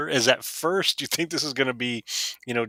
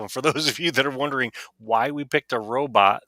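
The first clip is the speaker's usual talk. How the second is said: normal talking voice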